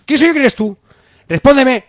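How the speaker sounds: rising into a loud high interrogation; building threat. Acted.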